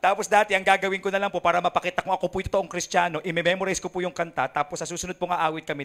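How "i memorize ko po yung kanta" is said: usual speaking voice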